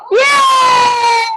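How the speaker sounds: bright cheer for a winner; excited cheer. Real, not acted.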